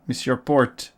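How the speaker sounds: a light put-on French accent, playful; mock French accent. Thanking a supporter in chat, light mock French accent. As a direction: greet him like a hammed-up Frenchman, French accent. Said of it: "Don't know why I decided to be French".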